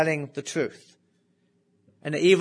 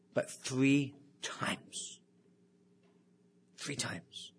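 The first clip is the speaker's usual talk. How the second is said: very soft, half-whispered and drawn out; hushed prophecy; 11 dB quieter, much slower than usual. Retold or imitated. Preacher quoting Jesus's warning that Peter will deny him, hushed. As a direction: let it sink almost to a whisper.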